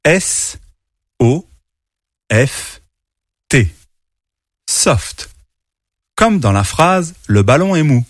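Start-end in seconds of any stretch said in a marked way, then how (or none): 0.0-3.7 letters S, O, F, T said one at a time; teacher spelling for children; spelled out. Shown as a demonstration. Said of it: "Il s'écrit en quatre lettres". 4.7-5.3 Soft. whispered; whispered English word. Shown as a demonstration. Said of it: "Je répète".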